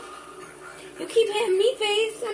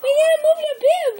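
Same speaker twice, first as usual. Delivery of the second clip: high playful urging; 8 semitones higher, 4 dB louder, faster than usual. Real, not acted.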